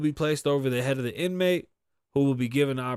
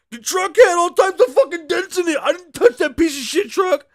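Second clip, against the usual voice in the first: much higher, louder squealing rant; whiny high squeal; 10 dB louder than usual. Retold or imitated. Mocking Benzino protesting to the cop, high and shrill. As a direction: squeal it like a wounded, outraged man.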